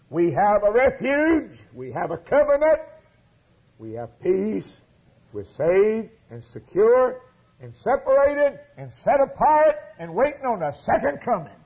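high, loud, self-assured mock boasting; mock smug confidence. Preacher mocking complacent religious people, loud and high. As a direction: ham up the complacent believer.

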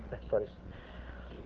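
whispered aside. Real, not acted. Preacher drops to an aside.

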